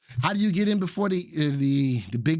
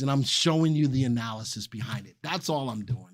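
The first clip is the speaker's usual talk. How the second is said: usual speaking voice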